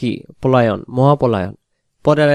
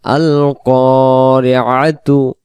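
slow chanted recitation, word by word; 4 dB louder, much slower than usual. Shown as a demonstration. Teacher recites word by word for students, slowly chanted. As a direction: draw each syllable out in tilawat. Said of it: "ভেঙে ভেঙে".

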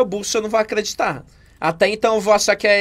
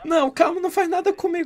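higher, pleading victim voice; acted frightened plea; 6 semitones higher, 3 dB quieter than usual. Victim begging a mock robber, higher and frightened. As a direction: beg the robber not to hurt you. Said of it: "você é muito boa atriz".

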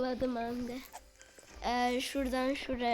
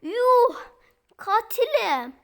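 excited shouting; 7 semitones higher, 5 dB louder, slower than usual. Real, not acted. Finds out he is killer.

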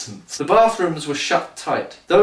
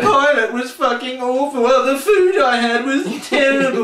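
high, nasal-free mocking whine, much higher; whiny complainer; 8 semitones higher, 4 dB louder than usual. Mocking the character by whining about his trip, high and hammed-up. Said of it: "all he does is piss and moan".

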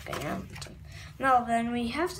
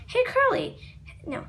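usual speaking voice

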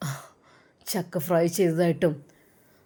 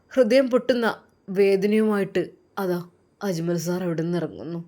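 ordinary delivery

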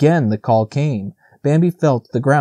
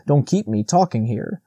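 ordinary delivery

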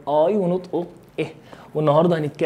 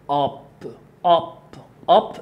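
American أب with open "ah", as "aap"; نطق أمريكي. Shown as a demonstration. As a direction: say أب with a long American vowel. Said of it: "انت معايا أمريكان؟". (لكنة أمريكية، أب بألف مفتوحة)

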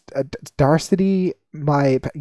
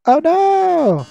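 much higher, stretched-out wail; mock alarm; 12 semitones higher, 5 dB louder than usual. Villain announcing the coming flames, high mock wail. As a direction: swoop up high in fake dismay.

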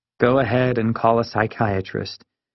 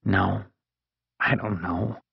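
softer, unsure admission; more softly; 3 semitones lower, 6 dB quieter than usual. A narrator's reading. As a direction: drop the volume as you back down. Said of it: "Then, more softly".

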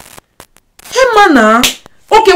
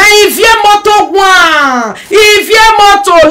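loud slow hawker call, rising high; 7 semitones higher, 3 dB louder, slower than usual. Acted. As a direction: shout the name like a bus conductor calling stops. Calling out a place like a hawker.

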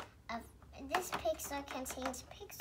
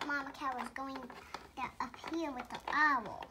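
ordinary talk

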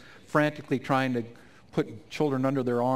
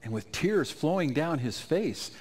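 ordinary talk

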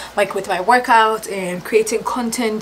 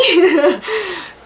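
bright laugh; 10 semitones higher than usual. Real, not acted. Laughing at own joke.

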